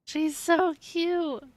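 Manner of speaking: high, slow delighted coo; gushing adoration. Real, not acted. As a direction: coo over something adorable, stretching it out. Gushing over adorable game character.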